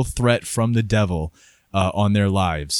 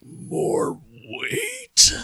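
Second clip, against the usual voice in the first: much higher, strained defiant croak; defiant Giles Corey. Acted. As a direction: spit it back at his torturers. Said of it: "And he's like".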